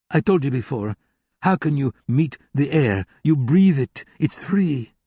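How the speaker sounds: a hint of a contemptuous German hacker lecturing. German hacker teases her.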